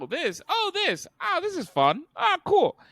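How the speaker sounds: high, breathless fake wonder; mock amazement. Friend mimics a moviegoer's high, hammed-up delight. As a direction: gasp at each thing like an easily dazzled kid. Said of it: "You're going".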